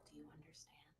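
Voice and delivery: soft hypnotic whisper. Acted. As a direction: whisper the question gently.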